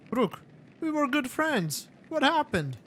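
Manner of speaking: a silky rival feigning hurt; lightly voiced warlord.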